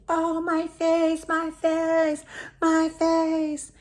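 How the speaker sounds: high, loud, drawn-out mock wail; imitated agonised scream. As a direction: wail in hammed-up agony as if burned. Man hit by acid cries out.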